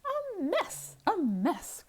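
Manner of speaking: high fretful hen echo; crying out. Acted. The second hen echoes in a high fretful cry. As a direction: fret over the mess. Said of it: "cried the other".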